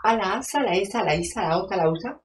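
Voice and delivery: loud, high fresa diva complaining; spoiled diva. Acted. Self-obsessed starlet fussing about her casting, loud and whiny. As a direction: whine like a self-absorbed celebrity. Diva persona holds throughout.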